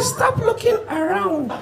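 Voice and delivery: high, soft, slow demon taunt. Acted. Demon taunting the trekking comedian, high and soft. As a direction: tease him slowly in a thin high demon voice.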